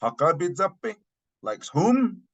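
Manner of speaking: high-pitched query, as if it wasn't caught; puzzled follow-up questions pitched well up; questioning listener. Acted. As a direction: ask it high, seeking a repeat. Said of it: "someone didn't really catch what you were saying".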